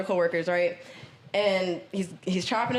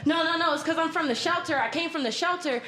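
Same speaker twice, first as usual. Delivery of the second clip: raised pitch, pleading excuse, lightly voiced; client excusing himself; 6 semitones higher than usual. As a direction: play the client talking his way out.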